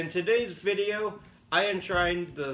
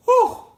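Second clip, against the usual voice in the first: big pained exhale, high and loud; high loud whew; 10 dB louder than usual. Real, not acted. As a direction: blow out the burn. Exhale from pepper pain.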